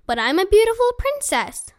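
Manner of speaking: high-pitched princess protest; indignant protest. A narrator's reading. Princess objecting to the indifferent witch, indignant. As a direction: climb high in entitled disbelief.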